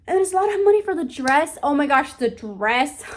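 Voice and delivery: overwhelmed bride venting about the dress price; exasperated outburst. Real, not acted. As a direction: blurt out the shock at the cost. Exasperated about wedding costs.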